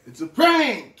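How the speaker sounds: loud, high gleeful shout; shouted reveal. Real, not acted. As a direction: yell it out triumphantly. Parent reveals the prank.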